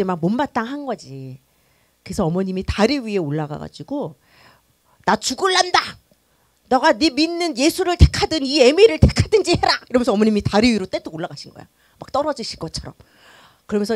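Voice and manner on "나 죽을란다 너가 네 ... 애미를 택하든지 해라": put-on high, loud, desperate cry of an old mother; mother on the bridge crying out an ultimatum to her pastor son; mother's desperate threat.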